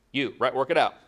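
ordinary delivery

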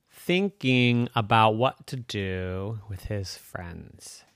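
ordinary delivery

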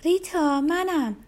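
high, loud call. A narrator's reading. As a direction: shout out to someone in another room. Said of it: "ربکا داد زد".

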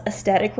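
"aesthetic" missing its TH sound; misspelled pronunciation. Shown as a demonstration. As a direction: read "aesthetic" as es-TET-ic. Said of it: "they spelt aesthetic wrong".

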